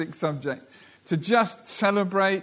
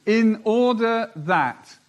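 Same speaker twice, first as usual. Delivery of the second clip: slow, loud, raised opening; emphasis on in order that; 5 semitones higher, 5 dB louder, slower than usual. Shown as a demonstration. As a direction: lean hard on in order that. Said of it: "And here's the emphasis this morning".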